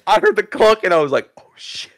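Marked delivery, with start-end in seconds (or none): 1.4-1.9 hushed unvoiced curse under the breath; whispered oh shit. Whispering after hitting a kid on the head. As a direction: whisper it like you just messed up.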